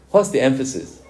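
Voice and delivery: normal talking voice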